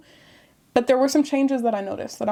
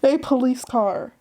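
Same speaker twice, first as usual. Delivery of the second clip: ordinary talk, nothing marked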